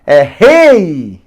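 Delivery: rei with the E drawn out and pressed hard; strong E in rei. Shown as a demonstration. Teacher showing students the strong vowel, stressed E. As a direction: lean on the E of rei, slowly. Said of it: "Esse E é forte".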